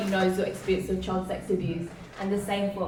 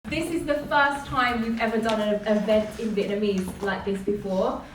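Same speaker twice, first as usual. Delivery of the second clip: ordinary talk, nothing marked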